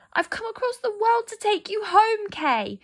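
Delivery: put-on high pleading girl's voice, a touch tearful; begging him to remember her, near tears; sobbing. A narrator's reading. Gerda pleading with frozen Kay, mildly tearful high voice. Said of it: "she sobbed".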